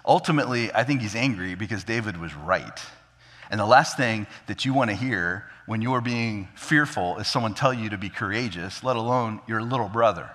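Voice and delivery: ordinary talk, nothing marked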